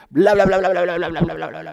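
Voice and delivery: loud, high, dismissive babble with ears covered; childish taunting over someone; mocking bla bla. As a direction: drown out the preacher like a stubborn child. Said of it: "se tapa los oídos y empieza a decir".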